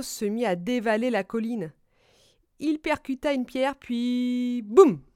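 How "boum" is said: sudden loud boom; crash effect. A narrator's reading. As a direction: burst out the word like a crash. Choc du chariot imité. (boum soudain et sonore)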